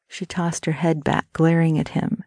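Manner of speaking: ordinary delivery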